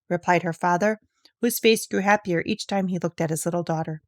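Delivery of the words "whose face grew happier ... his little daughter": usual speaking voice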